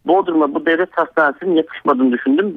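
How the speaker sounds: usual speaking voice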